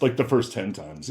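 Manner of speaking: ordinary talk, nothing marked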